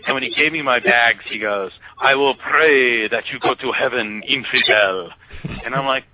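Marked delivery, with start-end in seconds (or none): 2.0-5.1 slow imitation of Egyptian accent; solemn blessing in a mock cabbie accent; Hussein. Cabbie bidding passenger farewell, slow imitated accent. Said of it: "I guess I'm still doing Hussein".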